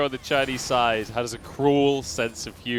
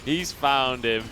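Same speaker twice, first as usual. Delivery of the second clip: ordinary talk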